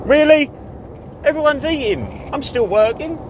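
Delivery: high, loud mock outrage; mock disbelief. Real, not acted. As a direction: play up the disbelief. Finds every driver is eating.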